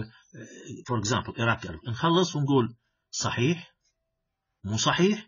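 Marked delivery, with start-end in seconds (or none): 3.1-3.7 صحيح with the pitch lifting at the end; rising intonation. Shown as a demonstration. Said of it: "with rising intonation". 4.6-5.2 مو صحيح lifting in pitch on صحيح; questioning upturn at the end of مو صحيح; rising intonation. Shown as a demonstration. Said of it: "with rising intonation".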